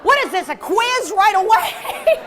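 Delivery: high, loud, drawn-out indignant outburst; hammed-up affronted cry. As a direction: protest as if ambushed with a test.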